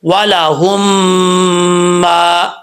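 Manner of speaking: وَلَهُمْ مَا recited with ghunna on the م; teacher recites وَلَهُمْ مَا; tajweed recitation. Shown as a demonstration. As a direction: join وَلَهُمْ into مَا with a nasal hold. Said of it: "کیسے پڑھیں گے؟".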